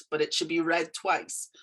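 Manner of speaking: ordinary talk, nothing marked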